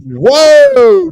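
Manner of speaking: loud high shocked whoa; surprised whoa. Real, not acted. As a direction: yell out in mock shock. Reacting to JFK callback joke.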